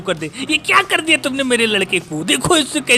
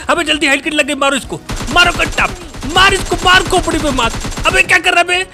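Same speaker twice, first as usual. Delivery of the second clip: frantic shouting; 4 semitones higher than usual. Real, not acted. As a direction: urge the teammate on in a panic. Frantic during fight.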